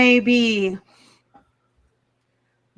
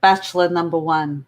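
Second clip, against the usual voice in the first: put-on heavy Russian accent; 6 semitones lower than usual. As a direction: play it as a Russian contestant. Miss Russia.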